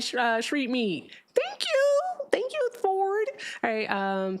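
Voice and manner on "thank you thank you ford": high squeaky thank you; excited. Real, not acted. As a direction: squeal it with delight.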